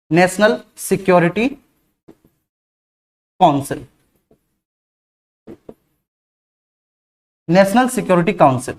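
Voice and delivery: slow dictation to students. Shown as a demonstration. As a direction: dictate it slowly so students can write. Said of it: "इसे English में कहेंगे".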